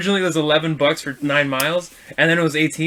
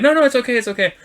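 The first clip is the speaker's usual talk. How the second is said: high-pitched imitated reassurance; customer's high, hasty soothing; insistent reassurance; 7 semitones higher, faster than usual. Customer to upset driver with dripping bag, reassuring.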